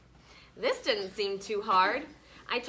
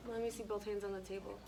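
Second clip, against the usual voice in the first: quiet correction; 2 semitones lower, 13 dB quieter, much faster than usual. Real, not acted. Teacher quietly correcting child.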